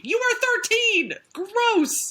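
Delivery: put-on high, outraged scolding of the thirteen-year-old; mock outraged. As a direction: exclaim in mock disgust. Scolding the teen character.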